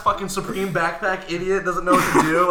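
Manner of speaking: loud, mocking rant; angry rant. Real, not acted. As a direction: sneer at the idiot. Ranting about backpack idiot.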